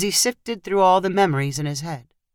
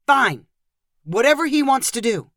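irritated, higher-pitched snap; snapped reply; 6 semitones higher than usual. A narrator's reading. Dad gives in to Grandpa, snapping. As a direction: give in with a touch of exasperation. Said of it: "Michael snapped in reply".

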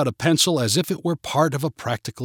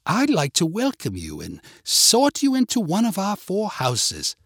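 higher, theatrical British welcome; British accent; 6 semitones higher than usual. A narrator's reading. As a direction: address them as a British headmistress. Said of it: "in her British accent".

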